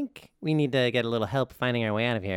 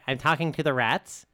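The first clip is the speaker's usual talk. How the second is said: ordinary delivery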